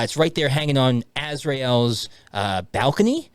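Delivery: usual speaking voice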